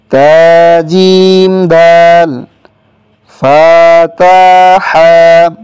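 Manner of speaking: slow, measured spelling of Arabic letters; slow letter drill. Shown as a demonstration. Teacher spelling out three-letter compounds for students, slow and measured. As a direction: spell each letter out deliberately for students to repeat.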